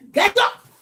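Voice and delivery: high, loud sudden command; retold wife's sharp yell; acted angry shout. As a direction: jolt a sleeper awake. Wife waking husband by hitting him.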